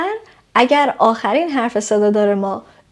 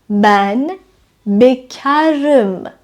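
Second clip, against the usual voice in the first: slow model sentence; much slower than usual. Shown as a demonstration. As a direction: stretch بکرم out very slowly, syllable by syllable. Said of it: "مثلاً". (آهسته و کشیده، برای آموزش)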